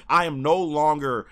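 normal talking voice

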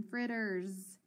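fritters said slowly with a buzzing z at the end; teacher stretching fritters to show the z sound; slow demo. Shown as a demonstration.

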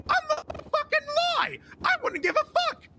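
high-pitched outburst. Pretend. Robbed-truck argument with the guys, high indignant outburst. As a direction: voice leaps way up in protest. Heated rant about being robbed.

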